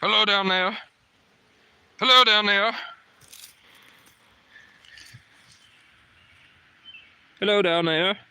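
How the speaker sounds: loud, high call down below; calling down from tower. Real, not acted. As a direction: shout it down to people far below. Calling down from height.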